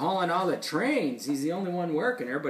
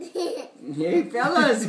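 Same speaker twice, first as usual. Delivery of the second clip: high, loud story-character call; voiced story character hailing friends; Wimp's line; 11 semitones higher, 8 dB louder, much slower than usual. A narrator's reading. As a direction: call out to the gang, pitched up. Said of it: "Wimp said".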